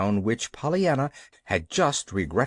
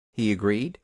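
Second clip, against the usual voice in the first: usual speaking voice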